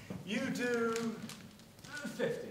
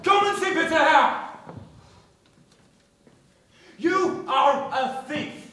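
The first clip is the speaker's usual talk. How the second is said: loud, slow furious shout; brand him a thief; angry accusation; 3 semitones higher, 7 dB louder than usual. Acted. Boss accuses boy of theft.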